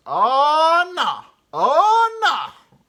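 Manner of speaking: very high loud wail of oh no; driver yelling again. Retold or imitated. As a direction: howl it in disbelief. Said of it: "And he goes".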